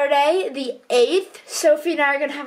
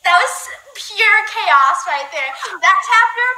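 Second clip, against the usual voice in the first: exasperated excitement; 6 semitones higher, 4 dB louder than usual. Real, not acted. Co-writer after a messy live stream, loud and exasperated. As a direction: let the chaos spill out loud.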